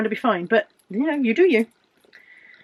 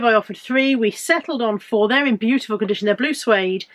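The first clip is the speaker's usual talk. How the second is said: ordinary talk, nothing marked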